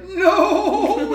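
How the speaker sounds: very high, loud mock wail; imitating crying toddler. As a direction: bawl it like a little child. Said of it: "And she's crying".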